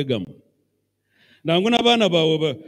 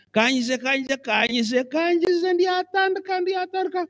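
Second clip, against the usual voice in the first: rising, higher and higher lazy excuses; mock procrastinator; 10 semitones higher, slower than usual. Preacher mocks a procrastinator, rising into a high whine. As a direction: mimic the dawdler as he climbs to a whiny high pitch.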